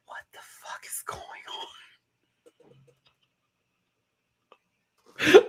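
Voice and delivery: whispered, shocked aside; whispered disbelief. Retold or imitated. Watching a castmate do vocal fry [name], whispering in shock. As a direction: hiss it under your breath in disbelief. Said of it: "I'm like".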